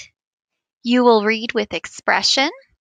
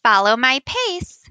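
normal talking voice

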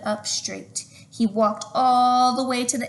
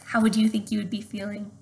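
ordinary delivery

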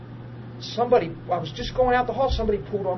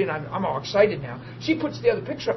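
usual speaking voice

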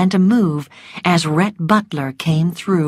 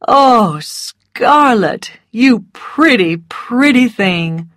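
raised exclamation, slowing to a fond close; fond admiration; 4 semitones higher, 4 dB louder, slower than usual. A narrator's reading. Ashley compliments Scarlett on coming home, fond admiration. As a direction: greet someone dear with delight.

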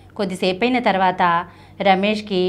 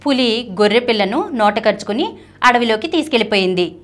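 usual speaking voice

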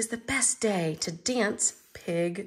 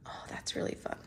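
softer, unvoiced delight; hushed little aside to the listener; whispered aside; 10 dB quieter, much faster than usual. Real, not acted. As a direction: lean in and whisper it. Reader's quiet aside.

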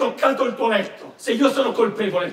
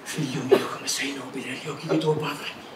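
faint, weak dying voice; low health; 8 semitones lower, 8 dB quieter than usual. Acted. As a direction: speak as if life is draining away. Dying son speaks to father.

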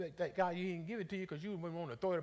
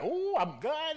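mock boast; 12 semitones higher, 9 dB louder, slower than usual. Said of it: "You'd be like".

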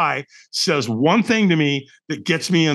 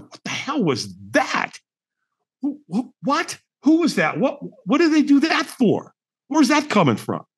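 bewildered reaction; 8 semitones higher than usual. Retold or imitated. Retold bewilderment at stranger's message.

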